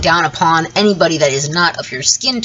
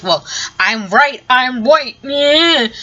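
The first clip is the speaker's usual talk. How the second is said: higher, drawn-out mock boast; mocking imitation; 7 semitones higher, slower than usual. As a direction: mimic a self-righteous arguer, slow and rising higher. Mimics the other side's smug argument.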